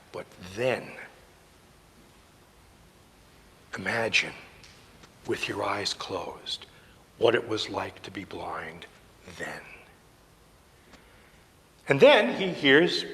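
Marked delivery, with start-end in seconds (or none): 0.1-9.6 very soft and low, near a whisper; hushed, spaced-out imagining; hushed guiding. Real, not acted. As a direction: drop almost to a whisper and draw them inward. Guiding listeners to imagine blindness.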